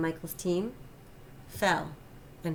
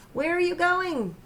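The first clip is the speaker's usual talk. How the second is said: put-on high, loud shout after a running boy; calling out; 11 semitones higher, 6 dB louder than usual. A narrator's reading. Father yelling after his son as he runs off. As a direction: yell it after him as he runs off.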